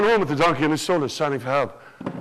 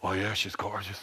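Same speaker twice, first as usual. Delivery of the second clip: much lower, softer appreciative lad; low Jack voice; 12 semitones lower, 12 dB quieter than usual. Acted. Jack eyeing the princess in a low, soft voice. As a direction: say it low and admiring. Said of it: "He went like this".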